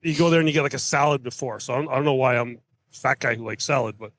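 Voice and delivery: normal talking voice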